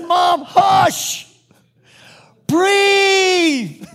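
very high, loud command, last word stretched; exasperated shout. Retold or imitated. Son hushes talkative mother in hospital, exasperated shout. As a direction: yell it to shut her up, hammed-up.

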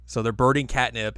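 usual speaking voice

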